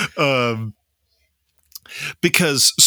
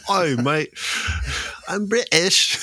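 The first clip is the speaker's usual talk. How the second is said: put-on hammy British accent, pitched up, through laughter; terrible British accent; 9 semitones higher than usual. Host laughs at himself, hamming a terrible British accent. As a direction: play a cartoon Brit badly on purpose. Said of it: "my accents are terrible".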